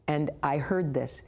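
usual speaking voice

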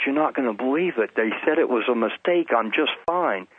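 wife breaking good news to her husband, mildly excited; retold excited news.

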